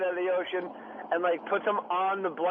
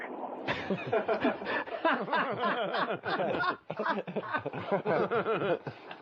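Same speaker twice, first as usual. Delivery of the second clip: long burst of laughter; drawn-out, helpless laughing; 2 semitones higher than usual. Real, not acted. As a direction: crack up at the punchline. Room laughs at the punchline.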